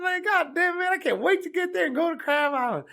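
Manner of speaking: high, impatient Kentucky caricature; hammed-up excited caller, very high; David. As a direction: burst with eagerness in a put-on country voice. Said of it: "he's from Kentucky".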